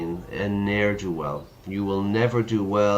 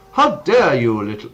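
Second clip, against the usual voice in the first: put-on a touch of an indignant English accent, louder; 3 semitones higher, 7 dB louder than usual. Frenchman scolds the girl in a mild English accent. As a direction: snap it like a prim English lady, a touch loud. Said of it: "no, that's an English accent".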